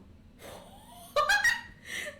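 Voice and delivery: high, loud burst of laughing; real shrieking laughter. Real, not acted. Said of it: "The big one's like".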